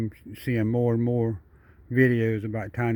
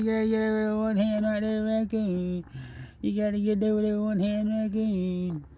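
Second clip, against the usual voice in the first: much higher put-on critter voice, teasing; raccoon's voice; 9 semitones higher than usual. The raccoon ribbing the man for fumbling one-handed, in a much higher put-on voice. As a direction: squeak it out like the raccoon mocking you. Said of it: "He said".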